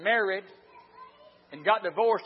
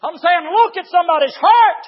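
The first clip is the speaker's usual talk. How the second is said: raised, emphatic pulpit exhortation; full-force preaching; 7 semitones higher, 11 dB louder than usual. Real, not acted. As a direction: drive each phrase home to the congregation.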